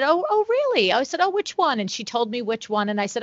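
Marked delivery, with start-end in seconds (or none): 0.0-1.8 high-pitched, retold surprise; acted surprise. Salesperson learning of the customer's referral, acted surprise. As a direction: lift the pitch right up in mock surprise. Acts out her surprise at news.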